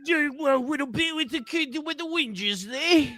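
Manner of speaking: high put-on Coruscanti voice, slowing at the end; Coruscant accent. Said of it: "You're Coruscanti".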